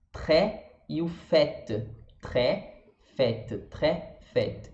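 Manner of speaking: tré and fête with open "é"; tré with throaty R, fête ending on a crisp T; open French E. Shown as a demonstration. Teacher giving French examples, open E. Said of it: "vai ser o nosso acento agudo do português".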